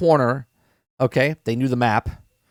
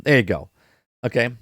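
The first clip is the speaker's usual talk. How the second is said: normal talking voice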